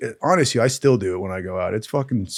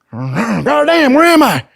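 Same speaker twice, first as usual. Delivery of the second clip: loud, high mock yell on coming to; startled awakening; 12 semitones higher, 7 dB louder, slower than usual. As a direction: act jolting awake, bewildered. Buddy jolting awake from faint.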